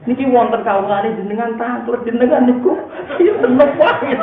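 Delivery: high, loud, stretched-out imitation of wailing; mock wailing complaint. Rabi'ah complaining to God about His servant's question, mock wailing. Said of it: "nangis terseduh-seduh".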